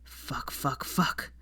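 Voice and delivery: soft breathy panicked swearing; muttered curses. A narrator's reading. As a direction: swear under your breath. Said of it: "muttered Draco".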